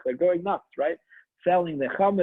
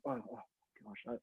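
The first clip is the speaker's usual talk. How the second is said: embarrassed hush; 5 semitones lower, 17 dB quieter, faster than usual. Acted. Embarrassed wife at the door.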